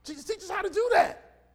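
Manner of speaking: high, blurted request; hammed-up excited pleading; eager disciples' plea. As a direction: call out eagerly, pitch jumping up.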